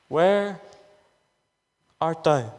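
low, slow call with long pauses between the words; God calling. Acted. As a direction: let each word hang like God calling. Refrain, low.

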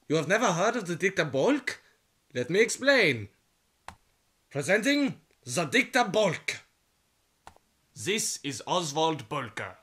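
usual speaking voice

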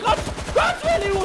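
very high urgent yelp; panicked warning. Real, not acted. Gamer telling Veli to flee, panicked high cry. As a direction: cry out for him to run, pitch way up.

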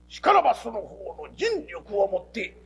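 slow, stiff mock-samurai diction; mock storyteller. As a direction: ham it up like a formal storyteller. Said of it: "お前が講釈してどないすんねや". (大げさな講釈師口調のまね)

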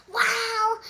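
high, loud amazed wow. Acted. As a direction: call it out in amazement. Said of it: "Jack said loudly".

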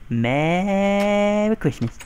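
high, stretched-out "merry"; drawn-out mock-cheery holiday call; singsong greeting. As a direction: slide way up and hold the first syllable. Gleeful greeting while stealing item.

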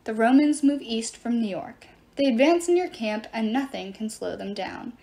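usual speaking voice

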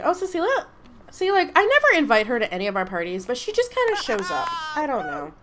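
ordinary talk, nothing marked

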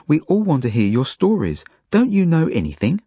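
cross, high-pitched scolding. A narrator's reading. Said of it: "says Zelda crossly".

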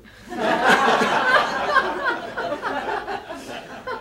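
big high burst of laughter; loud high laugh. Real, not acted. Speaker laughing at his own pub joke, loud and high. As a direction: crack up at the punchline.

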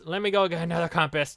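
ordinary talk

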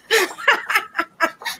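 high breathless laugh mid-workout. Real, not acted. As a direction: laugh through the effort.

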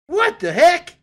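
high, loud startled cry; shocked. Real, not acted. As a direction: blurt it out in shock. Meteor flies back, shocked.

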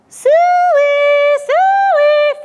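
high, loud, long-held hog call; farmer's pig call. A narrator's reading. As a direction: sing it out high to summon the pigs. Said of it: "Farmer called".

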